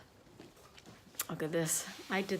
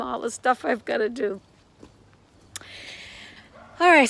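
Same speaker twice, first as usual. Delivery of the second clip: ordinary talk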